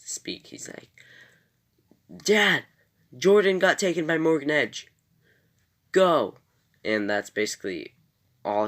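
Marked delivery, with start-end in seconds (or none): 2.1-6.4 high, loud frantic report to dad; urgent shout. Retold or imitated. Jonathan calling his dad about Jordan's kidnapping, loud frantic shout. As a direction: blurt it out, no time to waste.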